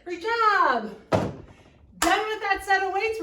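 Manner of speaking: loud, slow, triumphant coach cheer. Real, not acted. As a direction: celebrate finishing the set. Finishing set, cheering class.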